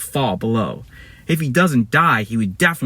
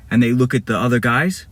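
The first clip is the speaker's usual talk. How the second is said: ordinary delivery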